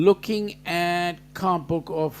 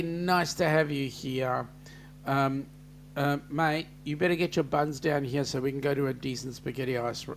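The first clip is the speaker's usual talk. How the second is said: ordinary delivery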